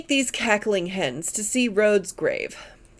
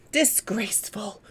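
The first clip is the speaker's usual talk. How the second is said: disgusted whisper; 2 semitones lower, 6 dB quieter than usual. A narrator's reading. Mrs Caldecott huffs in protest.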